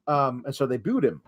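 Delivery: ordinary talk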